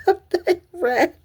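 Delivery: playful squeaky echo of the line; high squeaky repeat. Retold or imitated. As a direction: squeak the line out like a cartoon character.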